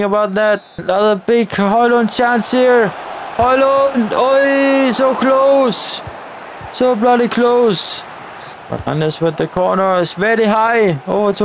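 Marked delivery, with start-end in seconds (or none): 3.4-8.0 excited near-miss shout. Real, not acted. Gamer yells at a near miss, excited. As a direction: shout as the shot just misses.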